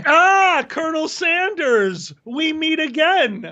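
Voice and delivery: high, theatrical arch-villain voice; villainous greeting. Acted. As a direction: greet your old enemy with relish.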